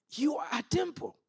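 ordinary talk

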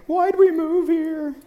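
high, drawn-out wail of complaint; mock crying wife. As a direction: imitate a wife wailing over her choices. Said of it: "my wife is just crying in the van".

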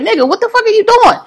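high, disbelieving exclamation; incredulous mock outburst. Speaker siding with neighbor, incredulous.

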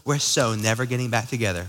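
a touch of a sulky breakup vow; mock breakup line. Preacher mimics a breakup to the congregation, mild mock sulk. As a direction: toss it off like a lightly played huffy ex. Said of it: "We, we say this in breakups".

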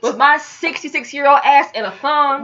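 high, loud disbelief; ranting in outrage; exasperated rant. Retold or imitated. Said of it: "I'm thinking".

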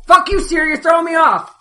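high, very loud outburst; exasperated high-pitched yell; annoyed shout. Real, not acted. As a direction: shout down an interrupting voice assistant. Scolding phone assistant that interrupted.

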